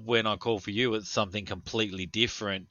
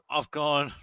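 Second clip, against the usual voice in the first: ordinary talk, nothing marked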